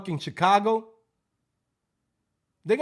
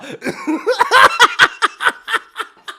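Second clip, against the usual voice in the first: loud laugh; 10 dB louder than usual. Real, not acted. Laughing hard.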